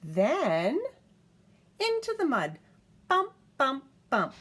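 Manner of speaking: high, loud bumpy emphasis; bouncing toddler storytime; dramatic read-aloud. A narrator's reading. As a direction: make each bump land.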